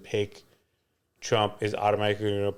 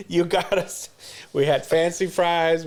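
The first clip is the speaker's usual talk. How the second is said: ordinary talk, nothing marked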